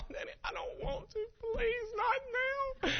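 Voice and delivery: squeaky, very high, tearful mock whine; whining daughter. Daughter refusing dad's cough medicine, crying whine. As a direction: drag out a child's crying plea.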